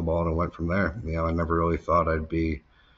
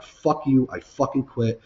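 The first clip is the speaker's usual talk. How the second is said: retold outburst, higher-pitched; angry quitting; 8 semitones higher, 5 dB louder than usual. Worker quitting on his manager, angry. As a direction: re-enact quitting in a raised voice.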